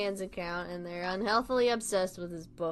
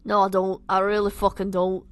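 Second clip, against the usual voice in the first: louder put-on Scouse; bad Liverpudlian accent; 6 dB louder than usual. Nick refusing his friends, bad Liverpudlian accent. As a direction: insist in a rough Liverpool accent. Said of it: "my shit Liverpudlian accent".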